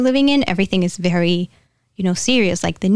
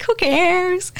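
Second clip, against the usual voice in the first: much higher, breezy "Who cares?"; carefree Jesus; 11 semitones higher than usual. Retold or imitated. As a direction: toss it off, totally unbothered. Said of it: "but he's like".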